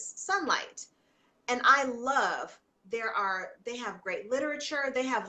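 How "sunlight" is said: Sunlight said much higher, with a bright falling tone; a sudden joyful "Sunlight" as the name finally comes back; remembered it. Real, not acted. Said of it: "There it is".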